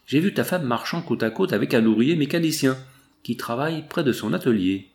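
ordinary delivery

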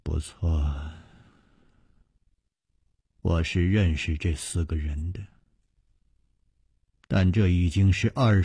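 put-on low, soft-spoken older lady, unhurried. A narrator's reading. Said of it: "他终于缓缓地说". (低沉缓慢的夫人语气)

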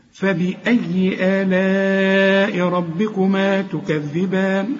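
slow, measured tajweed chant; Quran recitation. Shown as a demonstration. Teacher reciting a verse for students, slow Quran recitation. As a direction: recite with long held vowels.

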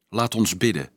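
usual speaking voice

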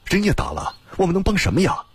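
high-pitched rhetorical complaint; exasperated refusal. A narrator's reading. Refusing the officers' plea for help. (不满推脱，高声反问)

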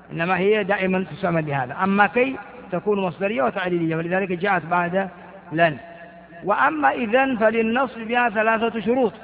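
ordinary talk, nothing marked

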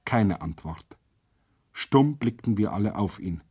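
usual speaking voice

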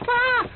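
sudden high yelp of fright; sharp frightened scream; startled cry. Acted. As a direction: jump and cry out in shock. Startled in dark grounds.

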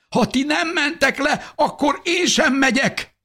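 put-on very high, distraught child's voice; sobbing boy. A narrator's reading. Son clings to mother refusing to go, sobbing. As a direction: cry it out desperately. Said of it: "kapaszkodott zokogva az anyjába".

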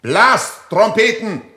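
loud call to the trumpets; shouting command. Acted. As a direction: bark the order across the field.

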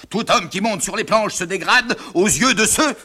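high-pitched moralising father; indignant pronouncement. Acted. Father condemns the stage.

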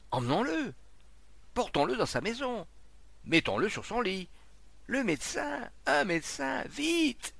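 high, urgent calls for help; urgent orders. A narrator's reading. Charron to the crowd over a fallen man, urgent calls. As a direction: call out orders as the alarm mounts.

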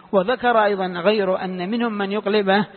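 ordinary delivery

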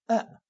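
آ as a bare open "a" sound; fatha vowel demonstration. Shown as a demonstration. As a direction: open the mouth and push out a short آ. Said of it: "ثم افتحه وادفع هواء فتحة".

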